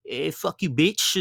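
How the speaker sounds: high, cocky trash-talk voice; crude persona voice. Retold or imitated. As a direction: toss it off like his rapper persona. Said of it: "bring it out every now and then".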